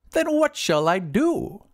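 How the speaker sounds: high, slow wizard voice; drawn-out high wizard question; helpless wizard. Acted. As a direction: ask slowly, high, as the wizard. Wizard at a loss.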